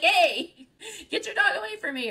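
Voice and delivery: hammed-up yell, laughing; mock yell. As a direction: snap at them half-joking. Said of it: "is yell at the other person".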